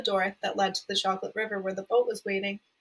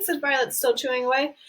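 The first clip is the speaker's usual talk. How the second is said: usual speaking voice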